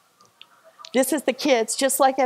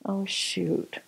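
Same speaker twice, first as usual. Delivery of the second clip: ordinary talk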